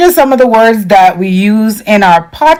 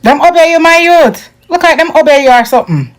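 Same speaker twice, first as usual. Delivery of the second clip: higher, quick Jamaican patois banter; 7 semitones higher than usual. Shown as a demonstration. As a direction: toss it off like a Kingston youth. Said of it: "In Patois, we say obia".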